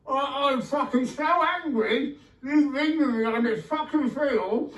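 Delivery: hammed-up angry yelling, high-pitched; mock furious rant. Shouting in mock rage at the bad field.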